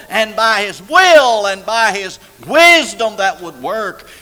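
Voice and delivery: loud, high, soaring preacher's cry; full-throated pulpit preaching; preaching at full force. Acted. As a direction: thunder it to the congregation.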